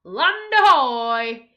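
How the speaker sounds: very loud, slow shout from above. A narrator's reading. Lookout calls from the crow's nest, very loud slow shout. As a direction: call it out across the ship. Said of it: "the crow's nest shouted".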